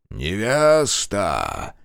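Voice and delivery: slow, drawn-out hiss from the dark; hissing monster voice. A narrator's reading. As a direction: hiss it slowly like a dead thing. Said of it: "зашипела тьма голосом".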